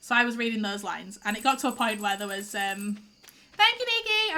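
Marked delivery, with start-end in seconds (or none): 3.5-4.4 much higher, mock-grateful call; sarcastic thanks. Real, not acted. Streamer thanking Nikki for the interruption, sarcastic high call. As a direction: sing out a fake thank-you.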